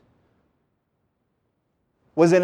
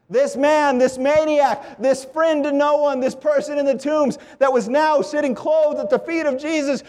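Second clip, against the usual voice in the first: higher-pitched, driving sermon cadence; impassioned preaching; 7 semitones higher than usual. Real, not acted. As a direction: build each repeated phrase like a preacher. Preacher builds to the quote.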